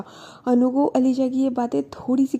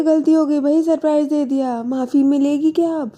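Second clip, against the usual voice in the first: louder, higher mock-sorry; sarcastic apology; 5 semitones higher, 5 dB louder than usual. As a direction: throw the apology back at her. (ऊँची, तेज़ आवाज़ में ताना भरी माफ़ी)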